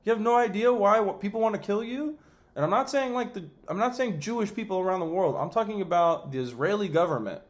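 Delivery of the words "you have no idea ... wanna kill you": incredulous retort. Retold or imitated. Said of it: "It's like".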